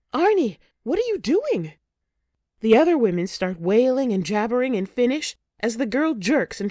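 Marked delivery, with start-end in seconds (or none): 0.1-1.7 high, shocked outburst; a startled, horrified shout; shocked cry. A narrator's reading. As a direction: cry out in horror at the sudden killing. Narrator sees a throat cut.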